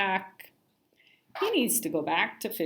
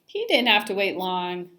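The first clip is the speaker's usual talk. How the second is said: ordinary delivery, nothing marked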